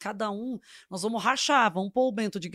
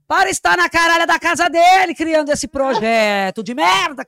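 angry outburst; 6 semitones higher, 10 dB louder than usual. Real, not acted. Venting about the colleague.

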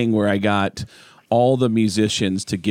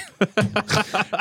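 laugh; 10 semitones higher, 3 dB quieter than usual. Real, not acted. Laughing at own story.

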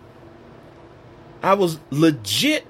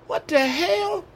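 ordinary talk

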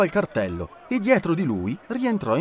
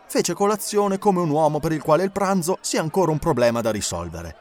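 ordinary delivery, nothing marked

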